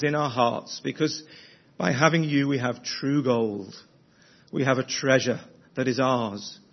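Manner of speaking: earnest, measured prayer voice. Acted. Leads a prayer at an evening service.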